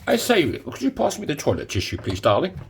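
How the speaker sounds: high, plummy upper-class put-on; posh voice. Mock posh to an imagined darling. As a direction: ham up a posh toff asking nicely. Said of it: "It makes me sound very, very posh".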